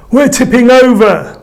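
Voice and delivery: high, loud frightened shout; panicked cry. A narrator's reading. Another sailor shouting in panic as the boat tips. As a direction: yell it as the boat tips over. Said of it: "cried another".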